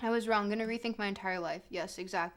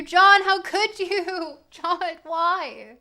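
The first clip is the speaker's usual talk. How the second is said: mock betrayal; 5 semitones higher, 11 dB louder, much slower than usual.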